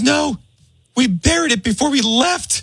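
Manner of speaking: high, startled neighbor's voice; the neighbor can't believe it; shocked Miss Lamont. Retold or imitated. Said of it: "She goes".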